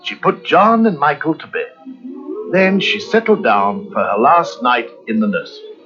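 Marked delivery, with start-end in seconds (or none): none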